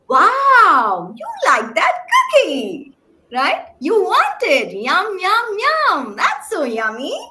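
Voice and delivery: high, sing-song voice with stretched vowels; parentese. Shown as a demonstration. Parent cooing to a child about a cookie, high and sing-song. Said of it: "using a high pitch, you're elongating the vowels, a sing-songy rhythm".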